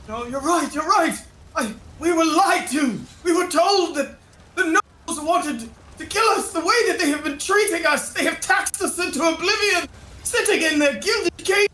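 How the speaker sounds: high, aggrieved citizen; heated high-pitched complaint; indignant crowd member. Acted. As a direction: protest as one of a wronged crowd.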